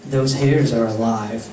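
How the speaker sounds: low, creepy revelation; dark, spooky aside; ominous Acid. Acted. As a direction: drop low for an eerie warning. Acid delivers a creepy warning.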